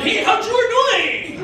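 high, slow, laid-back hey; casual chef greeting. Retold or imitated. Mexican chef greeting the family, high and slow. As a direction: stretch it out, easy and friendly.

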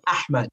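"Ahmed" with a breathy h from an open throat; open-throated Arabic h. Shown as a demonstration. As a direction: model "Ahmed" for a learner, h unscraped. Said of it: "try to open your throat".

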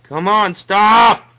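high, loud plea building in force; impatient shout. Real, not acted. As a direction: yell at the game to hurry up. Urging the game audio to stop.

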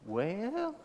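rising, drawn-out soft response from the pews; soft churchwoman's rising call; approving church women. Acted. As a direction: let it slide upward, quietly testing the preacher. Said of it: "raise their hand and say".

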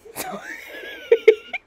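shrieking burst of laughter. Real, not acted.

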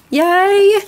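high, loud happy yay; excited cheer. Real, not acted. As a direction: celebrate it. Celebrating nine finished pages.

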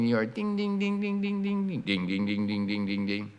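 bell sliding down in pitch after the crossing; dinging bell dropping. Shown as a demonstration. Explaining Doppler effect, bell pitch falling.

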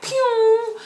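high, loud "pew"; whoosh noise. Retold or imitated. Fan running over to the star, zooming sound. Imitates herself dashing off.

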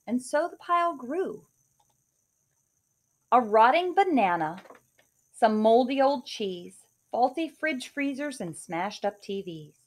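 put-on lively rhyming read-aloud for kids; story narration. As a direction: read it like a bedtime picture book. Reads picture book aloud.

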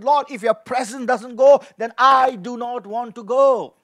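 ordinary delivery

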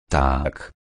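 low, soft ghostly intoning; like Hamlet's ghost. A narrator's reading. Rzecki answering the treasure hunters, low ghostly intoning. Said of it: "niczym duch ojca Hamleta".

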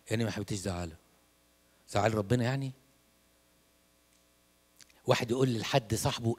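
usual speaking voice

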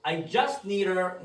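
I just need her를 천천히 줄여서; running around를 her처럼 뭉개 시범; slow reduced demo. Shown as a demonstration. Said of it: "좀 더 빠르게 발음되면". (축약 발음 시범)

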